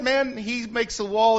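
ordinary talk, nothing marked

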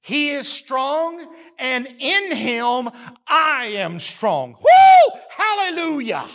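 ordinary talk

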